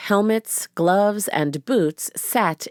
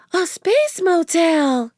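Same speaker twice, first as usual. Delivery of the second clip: put-on high, slow little girl voice; drawn-out young girl; 12 semitones higher, 4 dB louder, slower than usual. A narrator's reading. Narrator voices Annie.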